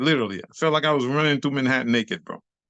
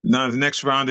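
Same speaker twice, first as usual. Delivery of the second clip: normal talking voice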